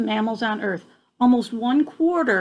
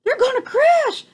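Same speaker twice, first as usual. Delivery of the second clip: gasped; 13 semitones higher, 5 dB louder than usual. A narrator's reading. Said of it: "gasped Stellaluna".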